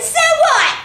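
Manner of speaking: high, loud hammed-up clown retort; defiant yell. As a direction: throw it back like a cheeky clown. Ronald snaps back defiantly.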